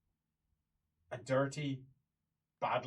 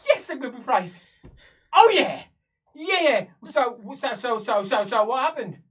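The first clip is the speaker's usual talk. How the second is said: loud, high, excited babble; cheer, then tumble over repeated words; jubilant; 9 semitones higher, 15 dB louder than usual. Acted. Prisoner celebrating success and demanding details, jubilant.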